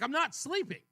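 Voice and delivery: indignantly retold comeback; irritated denial. Husband denies dozing off to his wife, irritated. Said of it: "irritated that she's saying".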